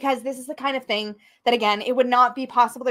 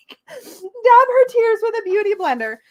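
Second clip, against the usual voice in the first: laughing through tears; 9 semitones higher, 6 dB louder than usual. Real, not acted. Laughing while crying.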